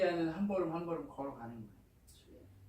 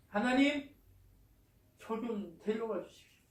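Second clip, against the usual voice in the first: acted desperate plea; 5 semitones higher, 5 dB louder than usual. Preacher re-enacts Elijah's desperate prayer.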